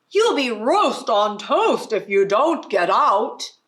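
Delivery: loud furious cook; loud angry threat; angry shout. Acted. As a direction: yell the animals out of the kitchen. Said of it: "with an angry shout".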